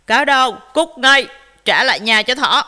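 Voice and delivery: very loud bear roar. A narrator's reading. Bear confronts fox at the door, roaring. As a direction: roar the order to get out. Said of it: "bác gấu gầm lên".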